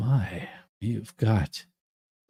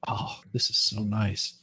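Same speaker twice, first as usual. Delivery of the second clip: soft, slow unvoiced whisper; hushed imitation of the wife's delight; whispered wife quote; 4 semitones lower, 8 dB quieter than usual. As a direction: breathe it out like a pleased wife. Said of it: "She's like".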